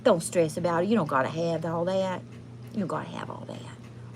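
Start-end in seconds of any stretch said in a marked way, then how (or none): 2.7-4.2 near-whisper, low and very soft; hushed reassurance. Real, not acted. Reader reassuring client not to stress, hushed reassurance. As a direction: let it sink to a soft, low hush.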